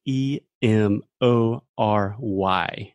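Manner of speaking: "E M O R Y" slowly, letter by letter; spelling out. Shown as a demonstration. Teacher shows the written form, spelling it out. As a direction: spell "E M O R Y" with each letter separate. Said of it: "the way we write it is".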